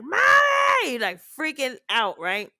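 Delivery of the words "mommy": high, loud mock shout for mom; panicked child yell. Mother imitating her child yelling for her, loud and high. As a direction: scream for mommy in a panic.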